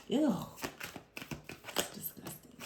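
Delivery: whispered in disgust. Real, not acted. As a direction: breathe it out with revulsion. Disgusted aside after hater voice.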